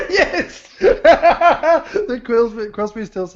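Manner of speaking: high-pitched, delighted cracking up; helpless laughter squeezing the words high; laughing hard. Real, not acted. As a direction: burst out high through laughter. Cracking up at the walrus comparison.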